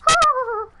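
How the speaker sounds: shrill high, loud clown cry; a sudden, piercing high clown call; eerie high voice. Retold or imitated. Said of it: "in all them voices".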